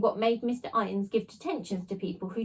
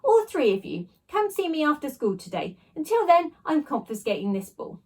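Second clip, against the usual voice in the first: stern teacher; 6 semitones higher, 4 dB louder than usual. A narrator's reading. Teacher punishing pupils.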